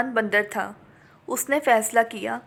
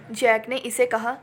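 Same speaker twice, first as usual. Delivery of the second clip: plain reading voice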